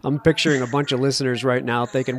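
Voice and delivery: normal talking voice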